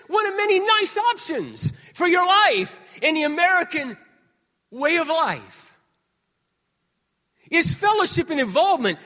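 high, loud rhetorical questions; full-force preaching. Acted. Preacher challenging the congregation, loud and high. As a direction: hammer each question at the crowd.

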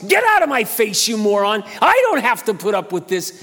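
high, loud pretend tirade; mock angry rant.

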